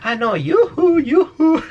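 high, bouncy mock "yuju"; sing-out camp self-correction; coña afeminada. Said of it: "intentado darle la coña de que está diciendo". (yuju agudo y burlón)

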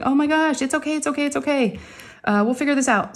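high soothing mom voice; calm her down gently; retold soothing of crying daughter; reassuring. Soothing hysterical daughter in bathroom.

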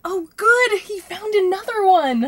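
loud delighted outburst; excited cheer. Acted. Assistant cheering boss on TV, loud delighted shout. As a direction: cheer at the screen.

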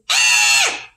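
short loud pretend scream; play-scared shout for the kids; mock scream. Teacher screams for the children, playful loud yelp. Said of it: "don't forget to scream".

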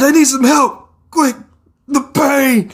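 high, strained call for help; injured plea. Acted. Injured victim begging rescuers for help, high strained plea. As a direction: plead through the pain.

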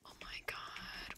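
barely audible, breathy whisper of shock; awed whisper. Real, not acted. To viewers on spotting new horses, awed whisper. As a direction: whisper it, stunned.

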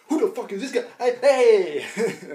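high, loud mock outrage with laughter; hammed-up high shouting; startled householder. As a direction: play a homeowner yelling at an intruder, amused. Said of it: "they go".

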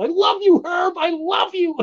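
high, gushing, lovestruck comic voice; lovesick gushing. Acted. Reading a smitten comic character's line aloud, high and gushing. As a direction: squeal it out like a smitten cartoon.

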